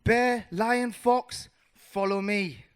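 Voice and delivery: loud, high rallying call. Acted. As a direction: summon your friends to follow. Mouse calls friends to follow.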